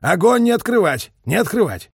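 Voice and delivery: high, loud order to hold fire; strained commander's shout; suddenly hoarse command. A narrator's reading. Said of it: "скомандовал вдруг охрипшим голосом".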